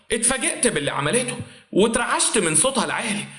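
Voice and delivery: ordinary delivery, nothing marked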